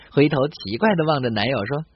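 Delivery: normal talking voice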